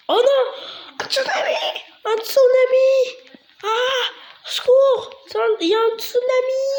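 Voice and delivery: high-pitched panicked shrieking; mock panic. Gamer warning of a tsunami, mock panic. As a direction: cry out for help in hammed-up terror.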